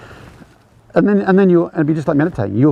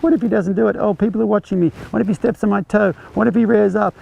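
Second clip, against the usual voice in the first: worried inner voice; 7 semitones higher than usual. Retold or imitated. Rider voicing anxious inner thoughts.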